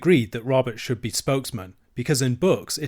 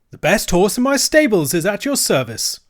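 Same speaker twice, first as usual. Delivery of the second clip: imagined servile dealer; 8 semitones higher, 7 dB louder than usual. Acted. Imagined polite dealer line.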